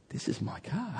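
high and very soft, "car" rising; dreamy near-whisper; awed hush. Retold or imitated. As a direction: breathe it out in amazement. Pastor savouring the car again.

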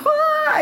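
very high mock shriek of pain. Waking back up into searing pain, imitated high shriek. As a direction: let out a long, piercing acted cry. Imitates painful return into body.

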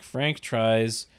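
ordinary talk, nothing marked

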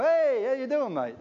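high, cheery put-on Scottish greeting; his Scottish voice. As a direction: answer back like a friendly local. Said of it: "I go".

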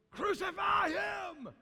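high, slow, shouted cry of the mob; crowd cry. Acted.

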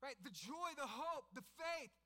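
building from lively telling to high, loud preaching; preaching at full force. Acted. Pastor urging the congregation that Jesus changes lives, preaching at full force.

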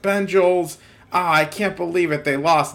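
shouted "Bengals" as "Ben-jals", ah I can't believe it, they lost; the character yelling about his team losing, loud and upset; mock sports yell. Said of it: "a sketch where he's yelling about".

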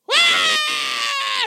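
very high, loud retold scream; mock girl's scream. Daughter screaming in the bathroom at sunrise, retold. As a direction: let out a shrill-pitched shriek. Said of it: "Why girls scream".

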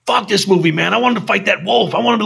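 high-pitched mock outrage; imitated pissed-off guys. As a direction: play an angry moviegoer griping, without shouting. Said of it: "they were really pissed off".

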